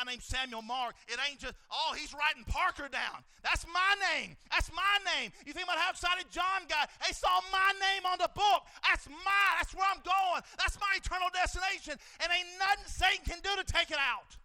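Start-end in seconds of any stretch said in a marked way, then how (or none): 3.4-14.4 very loud, high shouted praise; booming pulpit cry; preaching at full force. Acted. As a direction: raise the roof with it.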